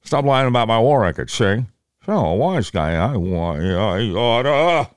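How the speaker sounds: put-on gruff grumbling old senator; Bob Dole impression. Dole defending his war record, gruff grumble. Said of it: "So you got Bob Dole".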